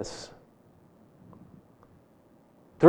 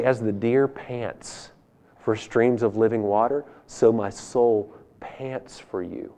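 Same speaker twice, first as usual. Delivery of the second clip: soft, slow, hushed prayer to God; tender prayer; 5 semitones lower, 8 dB quieter than usual. Acted. Preacher voicing David's prayer to God, softly tender. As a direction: breathe the psalm gently, like a lover.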